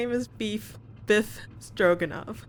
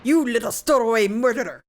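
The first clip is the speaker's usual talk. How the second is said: a hammed-up Russian sailor, snarling; bad Russian accent; 5 dB louder than usual. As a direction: growl it out in a mock Russian accent. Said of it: "my very bad Russian attempt".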